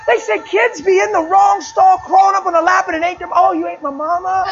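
loud, high, laughing through every word; laughing loud storytelling. Real, not acted. Preacher telling the church a funny story, laughing loudly. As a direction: howl it out to the crowd, cracking up.

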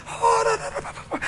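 high babbled da da, fading to breath; imitated tongues. Preacher imitating the girl praying in tongues, high then fading. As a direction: mimic a child praying in tongues.

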